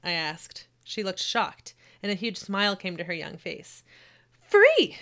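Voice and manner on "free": high, loud delighted burst; excited. Acted. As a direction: blurt it out overjoyed. Girl beaming with huge smile.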